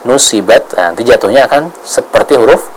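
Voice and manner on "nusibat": nusibat with a light, plain S; quick, thin-S nusibat. Shown as a demonstration. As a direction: show the mistake, nusibat with S thin like the letter sin. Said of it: "Kalau kita baca dengan tipis".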